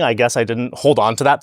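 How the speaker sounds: ordinary talk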